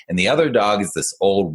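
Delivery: ordinary delivery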